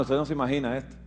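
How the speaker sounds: usual speaking voice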